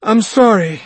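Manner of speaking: a frantic mother apologizing; desperate plea. Acted.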